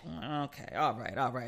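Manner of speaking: low, soft retold concession; quiet backing down. Daughter conceding to upset mother, low and soft. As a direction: give in under your breath.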